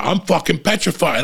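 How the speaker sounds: ordinary delivery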